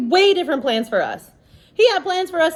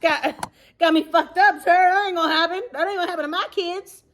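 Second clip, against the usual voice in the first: defiant mom; 7 semitones higher than usual. Retold or imitated. Voicing mom's defiance.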